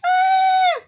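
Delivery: very high, loud shriek; frightened scream. Acted. Frightened scream at scary eyes.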